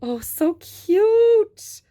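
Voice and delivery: slow, high, drawn-out coo; gushing coo. Real, not acted. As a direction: melt over how cute it is. Gushing over toy.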